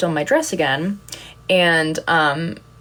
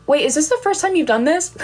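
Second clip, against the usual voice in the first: surprised fan; 10 semitones higher than usual. Retold or imitated. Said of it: "she said".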